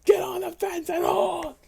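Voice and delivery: high, loud command; shouted order. Acted. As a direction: bellow the order across the field. Officer ordering men in game.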